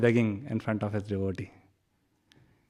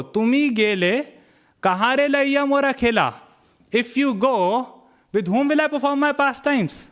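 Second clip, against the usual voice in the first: high, raised voice of a Lord pleading with his devotee; loving reproach; 10 semitones higher than usual. Acted. Lord Chaitanya chiding Murari for planning to leave, high and raised. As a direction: scold him as a hurt friend would.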